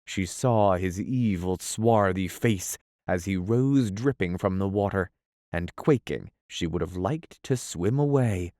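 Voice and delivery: usual speaking voice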